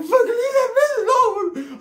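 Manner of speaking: high slow screeching yell; yelling at security, high and slow; shrill comic yell. Retold or imitated. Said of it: "I was like".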